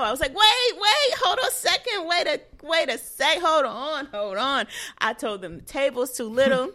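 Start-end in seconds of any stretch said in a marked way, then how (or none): 0.3-4.3 loud, very high frantic pleading; acted panic. Patient begging surgical team to wait, acted panic. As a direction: re-enact stopping them in a high-pitched panic. Retold panic at being rushed into surgery.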